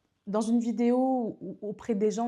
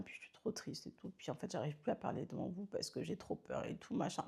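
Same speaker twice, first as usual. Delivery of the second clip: much softer, whispered sad voice; sad, scared; 3 semitones lower, 15 dB quieter than usual. Shown as a demonstration. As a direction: shrink away as if too scared to speak. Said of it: "je vous fais les petits yeux". (triste et apeurée, chuchoté très doux)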